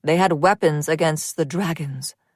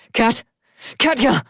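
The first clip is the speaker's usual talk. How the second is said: shouting out desperately for his wife; a husband's frantic shout; desperate calling; 6 semitones higher, 8 dB louder than usual. A narrator's reading. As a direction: call her name, desperate and loud. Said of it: "Axel's desperate voice calling for his wife".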